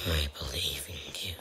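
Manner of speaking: whispering encouragement; whispered pep talk. Real, not acted. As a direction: whisper it to yourself. Pep talk to himself mid-game.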